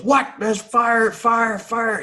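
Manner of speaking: high, loud-ish imitated alarm; mock panic. Naked sailor startled in the shower, high mock-panicked yell. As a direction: cry fire in a hammed-up panic.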